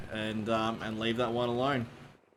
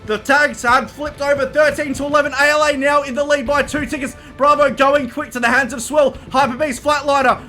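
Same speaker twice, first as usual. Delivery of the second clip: shouted, high-pitched, breakneck esports commentary; live play-by-play; 14 semitones higher, 14 dB louder than usual. Acted. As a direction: call the final cap at the top of your lungs.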